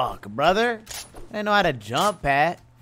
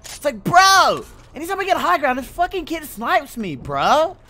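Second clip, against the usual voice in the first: frustrated rant; 13 semitones higher, 7 dB louder than usual. Real, not acted. Gamer complaining to chat about a sniper, loud high whine. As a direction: wail at the sniper like it's unfair.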